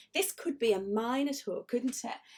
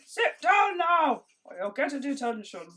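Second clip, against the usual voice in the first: a cross teacher barking at the class; stern teacher; 4 semitones higher, 4 dB louder than usual. Acted. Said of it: "You might be going".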